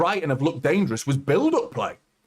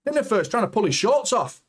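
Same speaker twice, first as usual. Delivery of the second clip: higher, mock-indignant imitation of the fouled player; mock victim voice; 9 semitones higher than usual. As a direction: play the player being grabbed, jokingly offended. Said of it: "He tries to".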